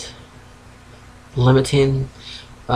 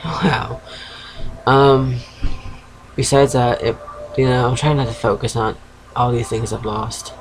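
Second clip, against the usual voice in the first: usual speaking voice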